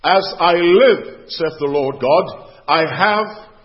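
loud, slow, weighty Bible reading; preacher solemnly proclaiming God's words; emphatic scripture reading. Acted. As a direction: declaim the verse, leaning on key words. Reads scripture with preaching force.

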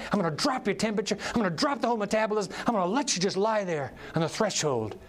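ordinary talk

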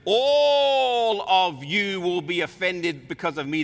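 solemn pronouncement. Acted. Jesus addresses the disciples.

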